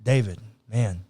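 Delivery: easy, buddy-like reassurance; casual God. Retold or imitated. God reassuring David like a friend, low and soft. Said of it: "God goes".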